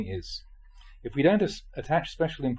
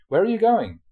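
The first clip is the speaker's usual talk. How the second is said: calling out a question; 7 semitones higher, 6 dB louder than usual. Retold or imitated. Asks wandering monks a question.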